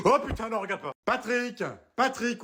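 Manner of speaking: gasped, high alarmed cry; sudden panic. Acted. Wife panicking over the message, calling for Patrick. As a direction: gasp and panic, then call out.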